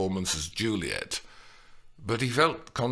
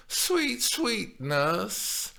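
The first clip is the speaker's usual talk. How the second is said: cooing appeasingly; 11 semitones higher, much slower than usual. A narrator's reading. Juliet coos to soothe her grumpy nurse. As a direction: coax her sweetly. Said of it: "she cooed appeasingly".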